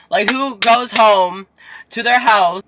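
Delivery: slow, loud, high-pitched disbelief; outraged rant. Real, not acted. Complaining about parents waiting at home, outraged rant. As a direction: belt out each word in indignation.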